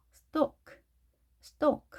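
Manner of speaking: "stalk" said as "stawk"; silent L. Shown as a demonstration. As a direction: drop the L in "stalk". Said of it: "이렇게 읽어주시면 되겠습니다". ("stalk"를 "스톡"으로 발음)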